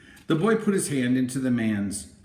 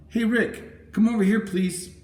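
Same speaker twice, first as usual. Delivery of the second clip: calling out; 11 semitones higher than usual. A narrator's reading. Girl calls her brother over.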